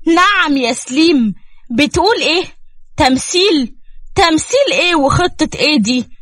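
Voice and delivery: furious woman confronting him; angry outburst. A narrator's reading. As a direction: demand answers, outraged. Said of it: "وزيزي بعصبية راحت له".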